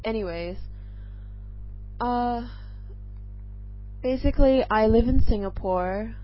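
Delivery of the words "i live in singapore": normal talking voice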